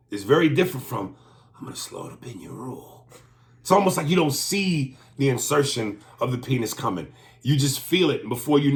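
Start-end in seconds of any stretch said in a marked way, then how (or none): 1.6-3.0 lower, much softer bedroom line; hushed mock seduction; soft seductive take. Contrast seductive version.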